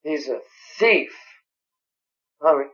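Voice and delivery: ordinary talk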